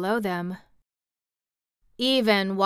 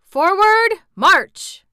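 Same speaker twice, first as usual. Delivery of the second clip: commanding; 11 semitones higher, 11 dB louder, much slower than usual. A narrator's reading. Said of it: "commanded the captains".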